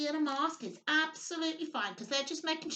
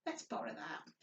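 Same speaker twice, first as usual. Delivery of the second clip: whispered aside; 8 semitones lower, 10 dB quieter than usual. Real, not acted. Aside while taking the mask.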